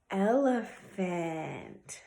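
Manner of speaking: ordinary talk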